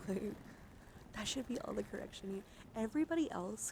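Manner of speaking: ordinary talk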